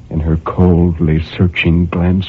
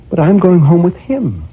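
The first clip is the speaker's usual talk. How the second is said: surprised; 10 semitones higher, 3 dB louder than usual. A narrator's reading. Woman surprised.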